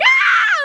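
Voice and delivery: very high, loud mock squeal; relieved squeal. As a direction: let out a shrieky squeal of relief. Relieved cry after dad's harmless answer.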